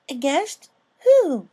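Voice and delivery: put-on a small girl's eager question; curious filly. A narrator's reading. Sweetie Belle asks curiously.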